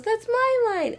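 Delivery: very high, offended little cry; high-pitched Aqua protest; Aqua's indignant squeak. Retold or imitated. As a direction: squeak it out like a goddess whose line was stolen. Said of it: "And then Aqua goes".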